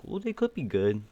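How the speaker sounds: lightly voiced hopeful Cowboys fan; mock hopeful fan. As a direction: hedge it like a doubtful fan talking up his team. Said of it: "a lot of guys that are like".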